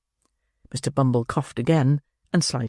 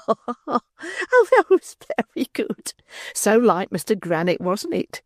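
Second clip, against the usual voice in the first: much higher, giggling delight; laughing matron; 9 semitones higher, slower than usual. A narrator's reading. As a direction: laugh along, tickled.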